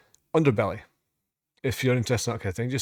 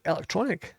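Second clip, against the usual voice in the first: higher, softer questioning echo; puzzled surprise; 6 semitones higher, 6 dB quieter than usual. Real, not acted. Reactor doubting a listed genre, softly surprised question. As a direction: query ELECTRONIC as if you misread it. Queries what was just read.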